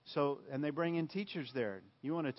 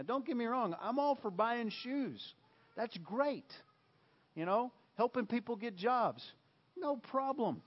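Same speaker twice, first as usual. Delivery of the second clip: much higher, breezy concession; light high aside; 8 semitones higher than usual. Real, not acted. Preacher reassuring listeners he supports charity, high and light. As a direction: toss it off lightly, pitched up.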